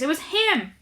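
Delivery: put-on boy shrieking an accusation; shrieked. A narrator's reading. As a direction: point and screech it out. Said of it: "he shrieked".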